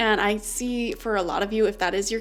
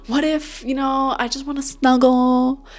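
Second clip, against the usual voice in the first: whiny inner voice; 4 semitones higher, 5 dB louder than usual. Retold or imitated. Voicing viewer's worried inner thoughts.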